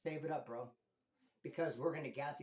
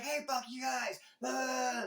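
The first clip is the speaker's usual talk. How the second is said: higher, shouted insult in another voice; mock angry yell; 6 semitones higher than usual. Dreamer yelling at the two who mocked him, mock angry shout. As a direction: yell it like the mocked guy losing it. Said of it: "He's like".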